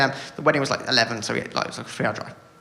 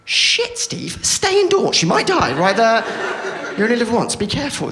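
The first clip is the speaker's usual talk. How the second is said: high, slow mock panic; inner panicked voice; 6 semitones higher, slower than usual. His inner voice warning him to stay home, mock panic. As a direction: squeak a warning to yourself. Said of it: "I think".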